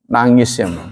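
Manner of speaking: ordinary delivery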